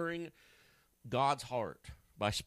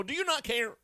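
high, loud, panicked mock cry; frantic wake-up cry; 12 semitones higher, 3 dB louder than usual. Disciples wake the sleeping Jesus in the storm, high panicked cry. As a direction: shake him awake in a mock panic. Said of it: "Somebody shaking you awake, go".

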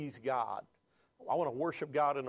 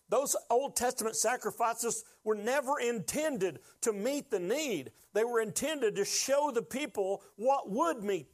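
higher, emphatic pulpit delivery; impassioned preaching; 6 semitones higher than usual. Real, not acted. Preacher proclaims Jesus as the Passover lamb, impassioned. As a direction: drive each point home to the congregation.

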